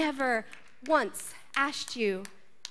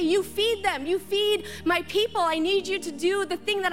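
raised, urgent command; voicing Jesus; 7 semitones higher than usual. Preacher voicing Jesus telling the church, insistent command. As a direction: press the charge on the listener. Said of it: "How dare Jesus look at you and say".

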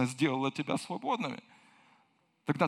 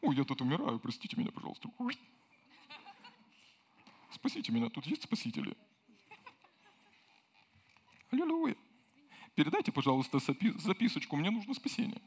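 lightly voiced, half-hearted polite plea; a mock-casual drowning man asking for help; 3 semitones higher, 4 dB quieter, faster than usual. Drowning man asking for rescue far too politely, a hint of softness. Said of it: "когда человек тонет".

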